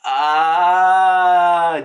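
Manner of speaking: loud, drawn-out "ahh"; loud cry of realization; long exclamation. Real, not acted. Teller reacts to the wordplay.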